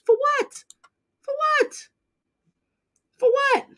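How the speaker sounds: pleading for a reason; exasperated. Real, not acted. Exasperated repeated question.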